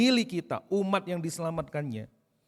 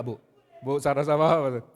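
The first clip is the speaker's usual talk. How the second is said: ordinary delivery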